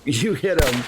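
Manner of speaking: ordinary talk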